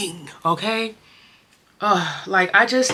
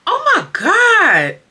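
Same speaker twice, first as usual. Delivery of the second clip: high, loud tearful gasp; choked up, about to cry for real; emotional cry; 8 dB louder, much slower than usual. Real, not acted. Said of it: "I feel like I'm about to cry".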